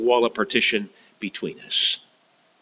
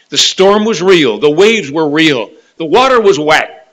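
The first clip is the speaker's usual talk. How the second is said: louder, insistent preaching; emphatic preaching; 6 semitones higher, 11 dB louder than usual. Acted. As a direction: hammer each fact home.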